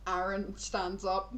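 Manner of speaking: ordinary talk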